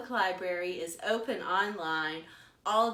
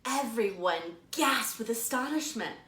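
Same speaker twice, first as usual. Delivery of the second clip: slow, lively storytime narration; animated picture-book storytelling; reading to children; 3 semitones higher, 3 dB louder, slower than usual. A narrator's reading. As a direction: read it like a librarian to little kids. Librarian reads picture book aloud.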